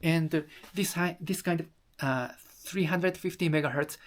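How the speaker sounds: ordinary delivery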